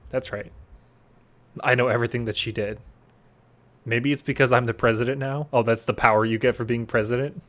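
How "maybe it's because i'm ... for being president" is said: ordinary delivery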